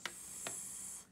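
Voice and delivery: long unvoiced S hiss; hissed S sound. Shown as a demonstration. Teacher showing S with voice off, sss. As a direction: stretch out a breathy sss. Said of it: "S is off".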